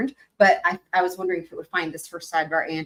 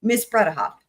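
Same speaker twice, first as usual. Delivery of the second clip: ordinary delivery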